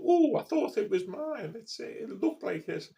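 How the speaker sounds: high mock innocent excuse; mocking mother. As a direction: parody her pretend surprise. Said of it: "she go".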